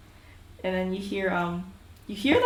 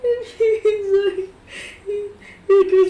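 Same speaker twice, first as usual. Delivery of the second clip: higher voice cracking with laughter; higher, laughing narration; laughing hard. Real, not acted. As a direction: tell it while cracking up.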